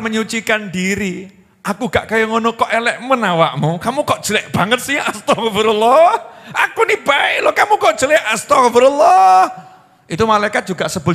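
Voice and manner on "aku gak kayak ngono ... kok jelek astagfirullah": mock aggrieved wife. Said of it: "Itu istighfar modus namanya itu". (istri ngomel sok istigfar)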